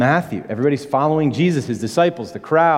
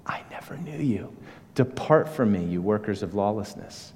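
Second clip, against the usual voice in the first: hushed judgment; 4 semitones lower, 7 dB quieter than usual. Acted. Jesus' words of judgment quoted.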